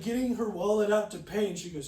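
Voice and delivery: ordinary talk, nothing marked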